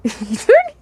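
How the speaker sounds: cracking up mid-line, high; laughing. Real, not acted. The reader breaking into laughter while retrying the line. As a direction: lose it into giggles on "dirty". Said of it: "Okay, let me relax".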